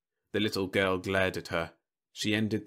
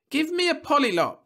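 put-on high, loud child demand; high-pitched pestering child; insistent little girl; 6 dB louder than usual. A narrator's reading. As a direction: demand it like a small kid.

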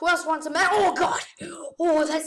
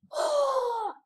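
a sudden high wail; panicked cry; 10 semitones higher than usual. Real, not acted. Cornered player crying out in panic. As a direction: cry out as the zombies close in.

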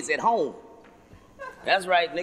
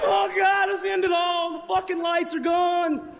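mock panic; 10 semitones higher than usual. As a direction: wail like the world is ending.

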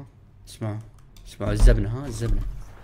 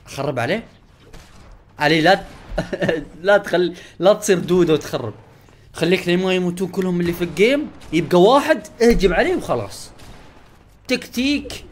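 ordinary talk, nothing marked

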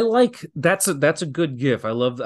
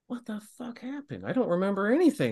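dazed confusion; 9 semitones higher, 3 dB quieter than usual. Retold or imitated. Concussed, asking what happened.

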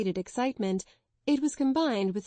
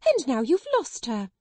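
higher, louder wild cry; cried wildly; 5 semitones higher, 4 dB louder than usual. Acted. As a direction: fling it at her sister. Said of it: "she cried wildly".

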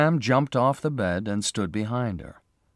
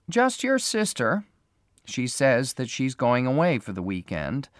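secretary; 4 semitones higher than usual. A narrator's reading. Secretary voiced by narrator.

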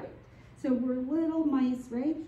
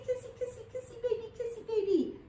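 put-on baby talk; 10 semitones higher, much faster than usual. Shown as a demonstration. Instructor kissing baby once again, high baby talk. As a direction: coo to baby in a tiny high voice.

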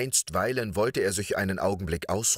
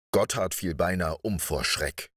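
ordinary delivery

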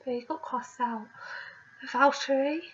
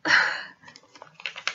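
weary sigh; 6 dB louder than usual. Real, not acted. Reacting to disliked moment.

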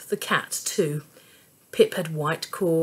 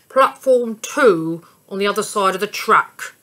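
a slightly louder ticket man voice; lightly voiced ticket man; 6 dB louder than usual. A narrator's reading. Ticket man directing the family, lightly voiced.

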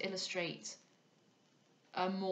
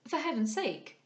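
higher-pitched startled exclamation; alarmed cry; 7 semitones higher, 5 dB louder than usual. A narrator's reading. Cecilia recovering from her consternation at the warning, alarmed cry. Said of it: "cried Cecilia".

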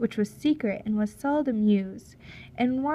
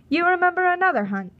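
a higher, louder, fast captain's challenge; pushy Russian captain; 8 semitones higher, 6 dB louder, faster than usual. Acted. Captain prodding the silent chief, higher, louder and fast. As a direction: needle him quickly about the old hunt.

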